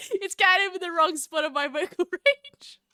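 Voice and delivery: high, loud protest through laughter; laughing retort. Retold or imitated. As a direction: crack up while quoting yourself. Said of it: "And I'm like".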